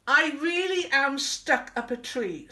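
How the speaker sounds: high, loud protest; insistent calling. Acted. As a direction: call it down, insisting it is true. Wilbur insists from up the tree.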